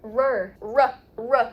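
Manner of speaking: "Rur", then "Ruh" as in rough, emphatic; syllable drill. Shown as a demonstration. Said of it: "Like rough".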